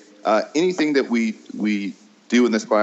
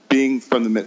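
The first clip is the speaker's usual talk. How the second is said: ordinary talk, nothing marked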